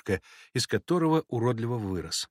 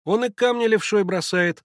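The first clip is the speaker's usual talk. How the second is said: put-on high, louder boy's voice; мальчишеское замечание; 9 semitones higher, 5 dB louder than usual. A narrator's reading. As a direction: call it out like a boy. Третий мальчик замечает, детский голос. (высоким мальчишеским голосом)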